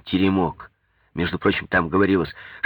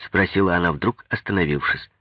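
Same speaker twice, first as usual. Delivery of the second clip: ordinary delivery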